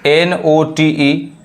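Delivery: letters एन ओ टी ई spelled very slowly; teacher spelling out the word. Shown as a demonstration. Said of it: "जैसे देखिए".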